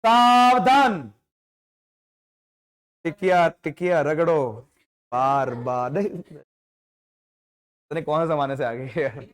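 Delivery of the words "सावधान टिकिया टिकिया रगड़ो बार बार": slow, drawn-out ad slogan; slow sing-song ad slogan; old ad jingle. Retold or imitated. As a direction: recite it like an old TV ad. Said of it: "तुमने सुना नहीं है?".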